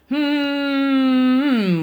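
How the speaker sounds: high humming drone; fridge hum. A narrator's reading. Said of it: "went the refrigerator".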